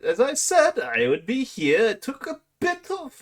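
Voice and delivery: high, swooping lofty voice; grand theatrical dragon. Acted. Dragon explains the arrival to the petitioner, lofty.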